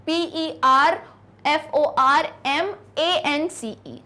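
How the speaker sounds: slow, letter by letter spelling. Shown as a demonstration. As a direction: dictate each letter clearly. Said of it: "आपको पता है यहाँ पे ई नहीं आएगा".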